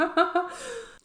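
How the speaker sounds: real high-pitched laugh. Real, not acted. Viewer tearing up at the pretzel date, high laugh. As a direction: laugh high while welling up.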